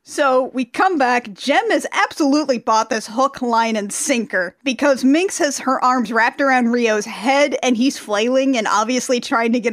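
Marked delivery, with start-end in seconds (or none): none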